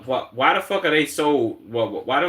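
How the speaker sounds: ordinary talk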